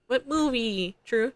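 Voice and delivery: usual speaking voice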